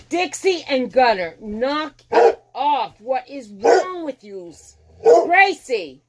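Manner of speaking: loud, high scolding shout at the dogs; yelling at dogs. Real, not acted. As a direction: bark orders at misbehaving dogs. Yelling at her dogs right now.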